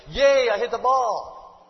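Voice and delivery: put-on drawn-out loud kid's yay; cheering kid. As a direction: cheer it out like a proud little kid. Child cheering after hitting ball.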